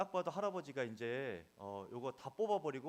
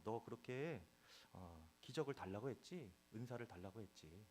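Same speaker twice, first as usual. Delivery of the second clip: God's gentle voice; 2 semitones lower, 7 dB quieter than usual. Retold or imitated. God gently speaking to the angry pastor, soft tender voice. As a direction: speak tenderly like the Lord comforting. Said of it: "이런 마음을 주시는 거예요".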